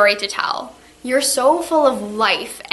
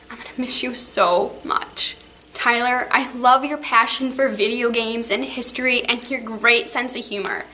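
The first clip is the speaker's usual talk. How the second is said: ordinary talk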